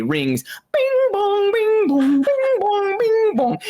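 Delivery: very high, slow bing bong chimes; high sung bell tones over the table. Acted. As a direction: sing the bells like a carillon. GM imitates ringing bells.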